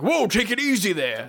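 startled hermit, lightly voiced; game hermit scolding the player, lightly voiced; old man character.

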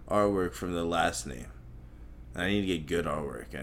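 normal talking voice

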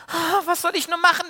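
a touch of tearful despair, pitched high; storyteller doing a small girl's worried sob. Acted. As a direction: voice Anna wringing her hands. Anna despairs, storyteller voices her.

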